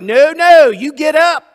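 loud, high commanding angel; angel forbidding worship. Retold or imitated. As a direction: order them up off the floor as the angel. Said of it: "the angel was like".

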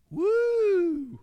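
high, loud "woo" of joy; excited whoop. Real, not acted. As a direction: let out a joyful whoop. Preacher's excited outburst.

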